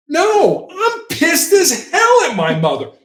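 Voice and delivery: upset subject shouting back at the negotiator; angry retort. Retold or imitated. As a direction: snap back, offended and furious.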